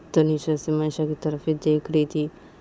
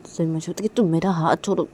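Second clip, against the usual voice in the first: ordinary delivery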